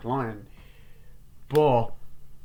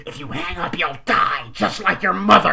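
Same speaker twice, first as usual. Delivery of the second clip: rising to a loud, higher roar; menacing threat; 7 semitones higher, 10 dB louder than usual. Retold or imitated. Killer threatening Sydney on the phone, building to a shout.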